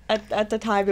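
ordinary delivery

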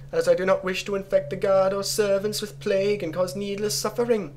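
put-on high-pitched, sing-along Jamaican-ish accent; bad pseudo-Jamaican accent. Player reading the sewage plan aloud, high pseudo-Jamaican accent. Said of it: "a really bad pseudo-Jamaican accent on occasion".

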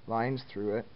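usual speaking voice

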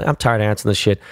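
normal talking voice

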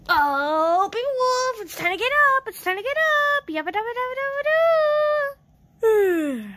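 high sing-song wake-up call; gently waking a child. Acted. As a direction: coax a sleepy child awake in a lilting sing-song.

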